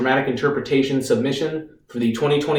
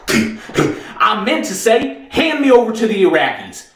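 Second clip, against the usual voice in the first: higher-pitched, declaiming soldier on trial; defiant defendant; 4 semitones higher than usual. Acted. Reeves rehearses his act for the lawyer, defiant and declaiming. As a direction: proclaim it as a grand, defiant act.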